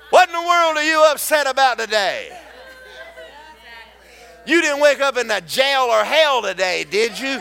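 hammered sermon rhythm with Hallelujah refrains; full-force preaching. Acted. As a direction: build the congregation up, shout it out.

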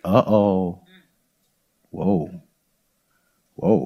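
normal talking voice

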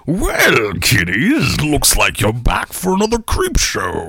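hammed-up horror host voice; slow, sinister horror host; Creep voice. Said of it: "I love the creep's voice in part two".

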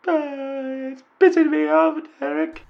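angry football fan in a high mock female voice; falsetto woman caller. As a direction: squeak it out like a fed-up lady fan.

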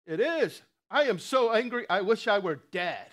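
loud, high, furious outburst of a sulking prophet; angry Jonah. Retold or imitated. Sulking Jonah shouts his anger at God. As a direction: yell it like a prophet who wants to die.

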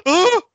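high, loud mock grunt; a leering huh from the desk; lecherous grunt. Mocking a commentator grunting at a pin, loud and high. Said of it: "only thing you'd hear over the commentator desk".

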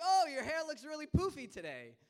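loud, high, over-eager compliment; overdone teenage enthusiasm; awkward compliment. Retold or imitated. Awkward teen attempting a compliment.

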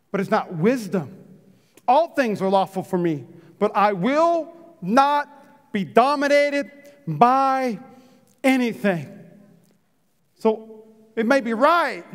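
ordinary talk, nothing marked

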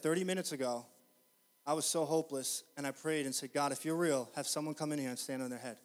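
low, soft, sober telling; hushed retold testimony. Preacher retells a weeping clerk's prayer, hushed.

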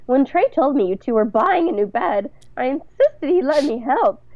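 high, affected society-matron voice; lilting and self-satisfied, showing off; Bunny, imperious mother-in-law. Acted. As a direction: play the meddling mother-in-law.

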